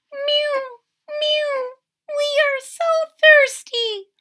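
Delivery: high squeaky kitten mewing; thirsty kittens. Acted. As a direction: squeak like tiny thirsty cats. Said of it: "cried the hundreds of cats".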